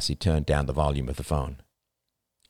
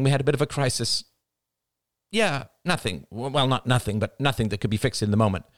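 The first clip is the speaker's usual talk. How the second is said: higher, quick, brightly sociable; 7 semitones higher than usual. A narrator's reading. Wife covering a crisis on the phone to Rachel, bright. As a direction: switch on a cheery phone voice. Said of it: "the bright transformation in her voice".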